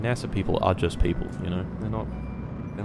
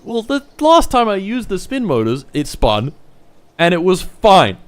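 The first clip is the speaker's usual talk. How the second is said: high, indignant, loud complaint; exasperated protest; 9 semitones higher, 6 dB louder than usual. Real, not acted. Streamer defending the spin motors, exasperated protest. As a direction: argue it in real frustration, pitched up.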